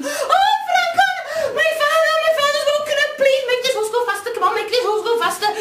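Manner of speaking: high, loud frantic plea; panicked girl. Acted. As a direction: beg him to hurry, laughing in panic.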